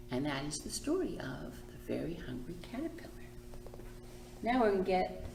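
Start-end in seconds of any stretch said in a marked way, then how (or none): 0.1-3.1 quick whispered title; whispered closing. A narrator's reading. Librarian whispering the story's closing line to children. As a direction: whisper the ending like a secret.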